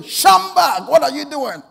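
very high, loud shout; rousing shout. Retold or imitated. Angel shaking the sleeper a second time, rousing shout. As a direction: shout to jolt a sleeper awake. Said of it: "the angel shook him again".

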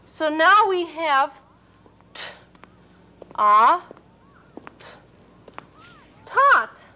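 letter sounds then the word; sounding out. Shown as a demonstration. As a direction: blend t, a, t into tot. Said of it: "sounding out letters".

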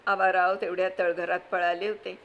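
ordinary talk, nothing marked

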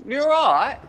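English greeting run into one word, high and rising; imitated English accent. Lifeguard showing the greeting in England, English accent. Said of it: "it's one word".

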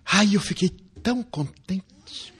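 ordinary talk

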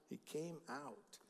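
quiet reverent restatement; hushed, slow repeat. Shown as a demonstration. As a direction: let it fall to a near hush, slowly. Repeated line, last one hushed.

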